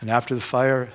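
usual speaking voice

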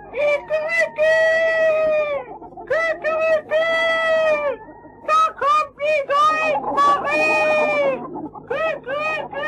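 very high, loud rooster cry; proud rooster crow. A narrator's reading. Rooster proudly announces Goldmarie's return, high crowing shout. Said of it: "Und der Hahn schrie stolz, so laut er konnte".

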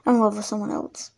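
ordinary talk

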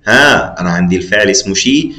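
ordinary talk

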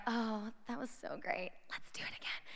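hushed, acted swoon; swooning whisper. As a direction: whisper it as if swept away by a movie. Acting girls after a romantic movie.

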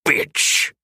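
harsh unvoiced bark through teeth; barked through bared teeth. A narrator's reading. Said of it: "Oberon barked through bared teeth".

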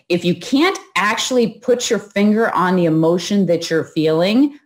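normal talking voice

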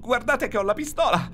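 would-be authoritative, coming out strident; shrill warning yell. A narrator's reading. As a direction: shout a threat that squeaks with fear. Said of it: "urlò, ma il tono autoritario ... riuscì stridulo". (urlo stridulo che vorrebbe essere autoritario)

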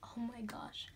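hushed, breathy oh my gosh; awed whisper. Real, not acted. Vlogger whispering in awe at her lights.

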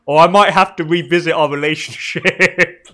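slow, loud, jokey warning; mock threat. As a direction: pretend to threaten a friend, hamming it up. Teasing threat to a Canadian friend.

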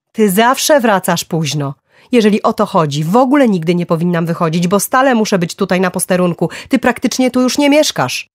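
loud, high tirade of grievances; angry reproach. A narrator's reading. Wife's accusations in the quarrel.

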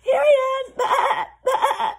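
very high, loud bleating shouts; desperate lamb crying out; frantic lamb. A narrator's reading. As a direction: bleat and call to be found. Lamb cries out to shepherd.

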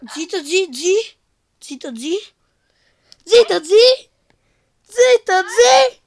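frantic rising hush; being chased. Real, not acted. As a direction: hush in growing panic.

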